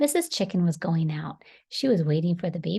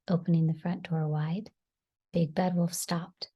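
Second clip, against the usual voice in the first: ordinary delivery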